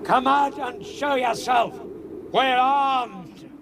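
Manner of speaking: challenging shout. Acted. Watson shouting across the moor at whoever threw the rock. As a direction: bellow a challenge at an unseen attacker.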